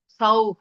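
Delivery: सौ released into a final h; with visarga. Shown as a demonstration. Teacher shows the corrected seed syllable, सौ with visarga h. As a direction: let सौ end on a puff of h. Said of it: "विसर्ग रहना चाहिए".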